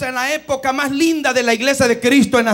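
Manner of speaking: loud, rising sermon climax; loud revival preaching; preaching at full force. Acted. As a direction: build the close with full conviction.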